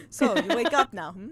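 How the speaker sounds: twink Russian sailor. Acted. Player re-voicing the sailor as a twink, lighter comic Russian accent. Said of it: "Oh, he's a twink, sorry".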